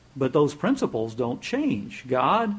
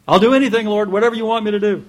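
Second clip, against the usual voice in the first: frantic promise; 8 semitones higher, 10 dB louder, faster than usual. Retold or imitated. Jack promising anything.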